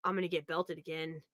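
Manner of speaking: put-on a scared kid's quiet groan of doom; dreading punishment. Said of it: "I was like".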